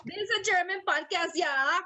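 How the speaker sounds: high, hammed-up German announcement; mock German accent. Host jokes to co-host in a mock German accent. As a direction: declare it in a silly German accent. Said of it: "German accent".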